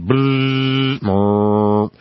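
buzzing tire hum over road grooves; rumble strip buzz. Retold or imitated. Imitating tires on the rumble strip, buzzing. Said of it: "you can hear your tires going".

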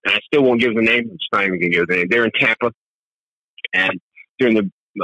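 normal talking voice

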